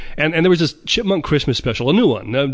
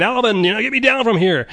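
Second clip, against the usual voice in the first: mock yell; 7 semitones higher than usual. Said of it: "he's yelling and screaming".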